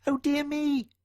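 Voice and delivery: high, tiny worried mouse. Acted. John Mouse worrying when Mister Simpkin sleeps on. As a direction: sigh it like a small fretful mouse. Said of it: "thought John Mouse".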